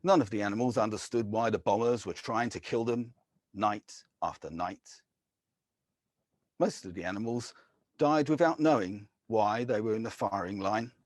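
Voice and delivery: normal talking voice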